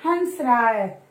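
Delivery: "हंस रहा है" drawn out, nasal held; slow demo. Shown as a demonstration. Teacher repeating the example slowly for pupils. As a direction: stretch "हंस" for the class. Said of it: "Ram क्या कर रहा है".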